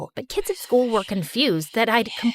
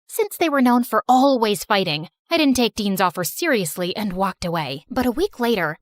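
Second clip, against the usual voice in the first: usual speaking voice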